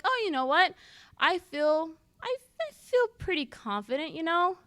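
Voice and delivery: unsure, halting claim of confidence; hesitant tone. Retold or imitated. Answering Devin's question about feeling, hesitant and unsure. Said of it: "with that hesitant tone".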